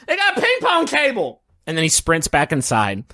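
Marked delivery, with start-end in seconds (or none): 0.1-1.4 put-on very high excited young boy; squeaky kid voice bursting with news. Mookie tugs Dad's sleeve, very high excited kid voice. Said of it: "He says".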